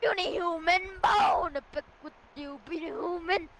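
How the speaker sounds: dragon voice. Pretend. Dragon taunting human.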